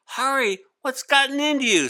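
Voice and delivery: teacher alarmed at Hari leaping across desks; shocked teacher. Acted. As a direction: blurt out in disbelief.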